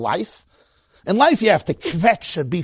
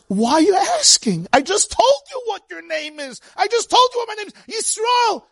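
Yaakov's answer, much higher and rising in urgency; impassioned Yaakov; 13 semitones higher than usual. Acted. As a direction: cry it out as Yaakov, pleading.

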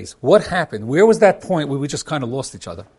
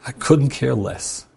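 cold dismissive; much slower than usual. Retold or imitated. What the wife heard, voiced coldly.

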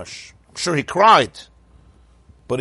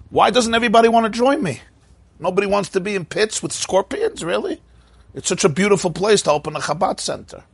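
sarcastic fake positivity, pitched way up; mock cheerful Yosef; 6 semitones higher than usual. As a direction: overdo the delight like it's all wonderful.

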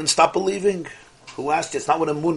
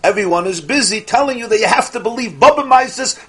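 ordinary talk, nothing marked

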